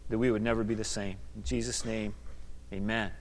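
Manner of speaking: soft, low prayerful voice; praying quietly to God. Acted. Opening prayer.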